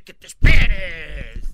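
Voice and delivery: hammed-up scolding of a crying baby; mock priest scolding. Retelling the priest scolding the baby, loud mock anger. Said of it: "lo agarra y le dice".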